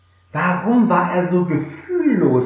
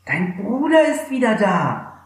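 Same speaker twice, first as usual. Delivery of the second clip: servant's reply; 8 semitones higher than usual. Acted. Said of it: "antwortete er ihm".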